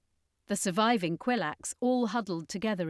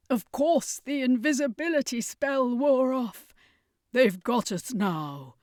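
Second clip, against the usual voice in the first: louder then slow, resigned; bitter despair; 5 semitones higher, 5 dB louder than usual. Acted. Circus master telling the others they're caught, bitter resignation. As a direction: give up the fight aloud.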